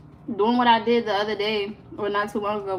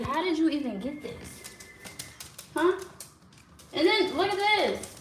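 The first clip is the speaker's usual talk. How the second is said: scolding the dog; 7 semitones higher than usual. Real, not acted. Scolding dog over found item.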